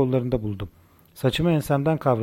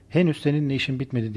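ordinary delivery

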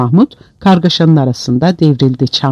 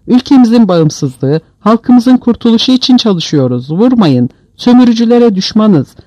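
pleading prisoner; 6 semitones higher than usual. A narrator's reading. Beaten prisoner pleads with soldiers.

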